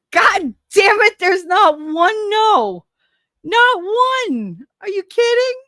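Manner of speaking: high, loud mock outrage; exasperated outburst. Real, not acted. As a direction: squeal in comic disbelief. Exasperated at poll result.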